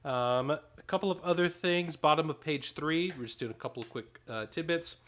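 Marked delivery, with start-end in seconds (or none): none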